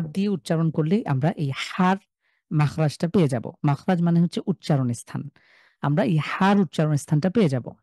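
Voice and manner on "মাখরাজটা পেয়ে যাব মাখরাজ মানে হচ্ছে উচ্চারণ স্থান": usual speaking voice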